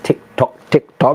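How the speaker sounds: a playful hint that the clock is ticking; teasing tick-tock. Shown as a demonstration. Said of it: "I keep going like this".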